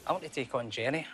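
ordinary talk, nothing marked

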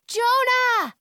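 high, loud holler; calling out. A narrator's reading. Girl calls to Jonah near the dragons, calling out. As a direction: shout the name across a distance. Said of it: "I call out between whistles".

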